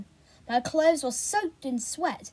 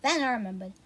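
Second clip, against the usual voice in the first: plain reading voice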